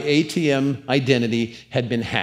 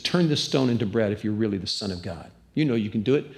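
tempter's voice; 6 semitones lower, 4 dB quieter, faster than usual. Retold or imitated. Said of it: "And he says".

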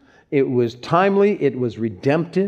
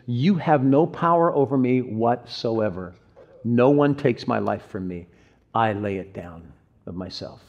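composed, unshaken reply; calm; 3 semitones lower than usual. Retold or imitated. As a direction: imitate calm, measured authority. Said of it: "I think, calmly says".

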